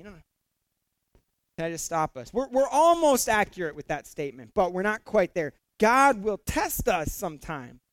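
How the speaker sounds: ordinary talk, nothing marked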